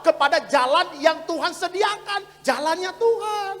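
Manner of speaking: loud preacher, rising in pitch; preaching at full force. Real, not acted. Pastor explaining repentance as turning back, preached at full force. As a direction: stretch the key phrases out for the crowd.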